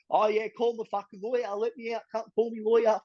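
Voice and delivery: brash and full of himself; cocky. Retold or imitated. Cocky self in watch house.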